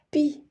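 "пі" alone with palatalised p; soft consonant sound. Shown as a demonstration. Teacher gives children the first sound, soft "пі". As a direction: sound out soft "пі" as one short syllable. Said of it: "м'який".

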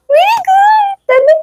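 very high-pitched delighted squeal; squealed praise. Real, not acted. Mother praising toddler for the bangle, squealing with delight.

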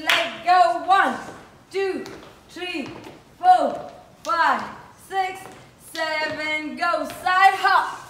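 loud calls of the count over the beat. Real, not acted. Dance coach to the class, counting them through the steps loudly. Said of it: "dance coach counting steps aloud".

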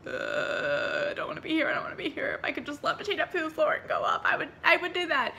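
scared. Retold or imitated. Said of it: "so I was freaking out".

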